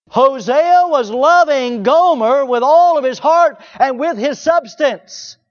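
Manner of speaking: booming preacher's emphasis; impassioned preaching. Acted. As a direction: lean on every word with fervor. Preacher stressing Hosea's love.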